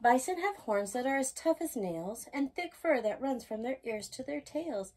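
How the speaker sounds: ordinary delivery, nothing marked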